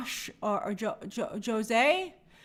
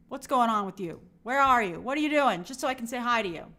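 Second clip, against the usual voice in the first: ordinary talk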